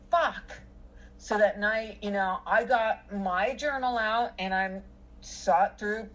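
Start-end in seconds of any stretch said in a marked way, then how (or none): 0.1-0.6 higher, frustrated swear; fed-up muttered curse at the situation; exasperated. Retold or imitated. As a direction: let it out in frustration. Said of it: "it's like".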